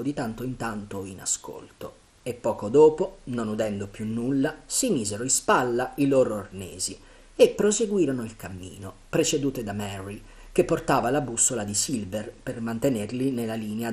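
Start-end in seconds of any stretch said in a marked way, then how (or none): none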